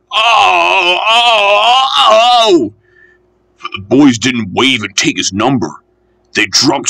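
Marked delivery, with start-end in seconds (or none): 0.1-2.7 high, drawn-out wail of "oh"; dismayed cries. A narrator's reading. Engine hit by dropped stones.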